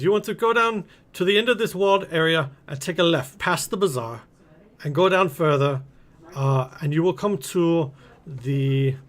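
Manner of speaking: put-on Kuala Lumpur-style merchant accent; foreign merchant accent. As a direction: give directions in a lilting Kuala Lumpur-ish accent. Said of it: "I don't know why they have this accent".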